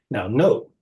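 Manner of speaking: ordinary talk, nothing marked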